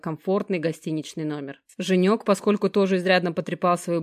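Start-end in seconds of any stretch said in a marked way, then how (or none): none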